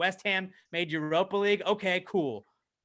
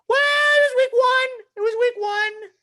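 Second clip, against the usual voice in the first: very high, loud squeal of denial; dumb brain voice; 7 dB louder than usual. Retold or imitated. His dumb brain excusing the loss, silly high squeal. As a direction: put on a silly, over-hopeful shriek. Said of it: "my stupid fucking dumb brain is like".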